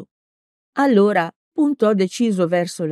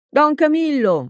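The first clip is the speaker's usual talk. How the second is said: shouted name; 9 semitones higher than usual. A narrator's reading. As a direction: yell his name across the field. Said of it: "urlò".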